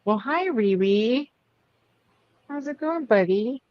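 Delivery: high sing-song greeting to a pet; pet talk. Real, not acted. Owner greets a poorly pet, high and cooing. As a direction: coo hello to your little buddy.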